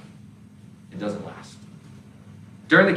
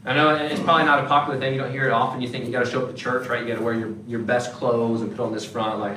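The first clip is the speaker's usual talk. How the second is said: ordinary delivery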